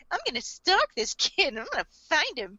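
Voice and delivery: high, cutesy voice with sinister intent; sweetly sinister kawaii impression; cheery kawaii girl. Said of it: "cheery, freaking kawaii-ass character".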